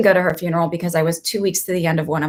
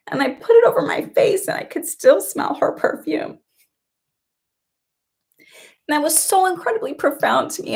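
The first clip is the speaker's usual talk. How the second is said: high, breaking voice through real tears; crying; 7 semitones higher than usual. Real, not acted. Telling viewers about grandma's perfume, crying. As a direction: let the tears crack every word. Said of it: "I'm crying more".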